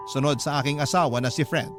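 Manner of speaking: usual speaking voice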